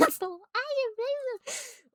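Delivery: very high, drawn-out mocking voice; mock high wail. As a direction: stretch it out way up high, hammed-up. Mockingly repeats the line, exaggerated.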